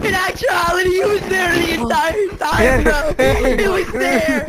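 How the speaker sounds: slow, loud, stretched out complaint; loud exasperated yell. Real, not acted. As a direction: yell it out in disbelief. Exasperated about the monster.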